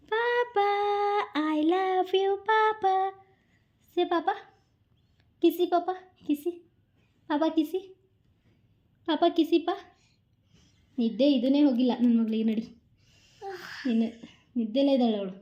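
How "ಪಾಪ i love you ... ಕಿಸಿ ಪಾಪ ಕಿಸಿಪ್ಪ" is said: much higher, slow, sing-song endearments. Real, not acted. Mother asking her little daughter for a kiss in high cooing baby talk.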